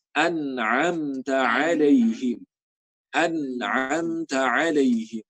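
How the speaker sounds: alaihim slow, ain from the throat; correct ain. Shown as a demonstration. Teacher modelling the correct ain. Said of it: "Harusnya hamzah yang di awal".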